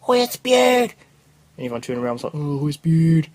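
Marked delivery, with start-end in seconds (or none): 0.1-0.9 high, loud mock squeal; shrieking classmate. Girl turns round in class to see the vomit, mock shriek. 2.3-3.3 loud mock group reaction; disgusted classmates. Classmates turn round after someone vomits, loud mock disgust.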